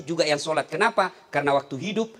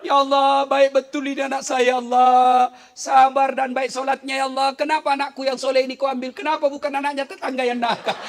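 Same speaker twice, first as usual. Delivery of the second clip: higher, louder hammed-up lamenting to God; mock lament; 7 semitones higher, 4 dB louder than usual. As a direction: imitate a mother wailing over her son. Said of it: "tidak boleh meratap". (meniru ibu yang meratap, dibuat-buat)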